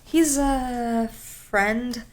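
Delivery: drawn out very slowly, hesitant and suspicious; extremely sus. Retold or imitated. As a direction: stretch it out like you're hiding something. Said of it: "make it sound so extremely sus".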